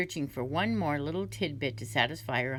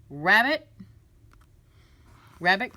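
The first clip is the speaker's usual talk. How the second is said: loud commanding call; king summoning the rabbit, louder; king cries out; 3 semitones higher, 8 dB louder than usual. Acted. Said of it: "cried the king".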